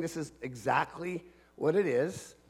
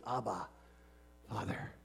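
anguished prayer; 9 semitones lower, 16 dB quieter than usual. Acted. Jesus praying in the garden before the cross, hushed anguish. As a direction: pray it haltingly, almost without voice. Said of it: "he's praying and he's in anguish".

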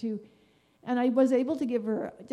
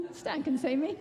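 laughing; 4 semitones higher than usual. Real, not acted. Laughing about rescuer Stan.

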